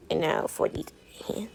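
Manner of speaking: breathy whisper, no voice at all. Real, not acted. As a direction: lean in and whisper it. Chuchote en passant aux mains.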